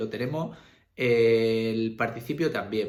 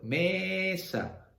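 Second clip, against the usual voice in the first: mesa with a high, stretched ME, falling on sa; stress on first syllable; 7 semitones higher than usual. Shown as a demonstration. Teacher giving an example word, stress on ME in mesa. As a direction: lift and hold ME in mesa. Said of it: "no es mesa, es mesa".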